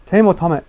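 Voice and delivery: first "Tame" as taym, then "Tame" as TAH-meh; English "Tame", then Japanese-style "Tame"; "Tame" rhyming with lame versus "Tame" said tah-meh; two pronunciations contrasted. Shown as a demonstration. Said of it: "How do you pronounce the name".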